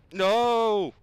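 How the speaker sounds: loud anguished no; frustrated shout. Real, not acted. Player shouting no as the character slips away. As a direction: yell it as the climb falls apart.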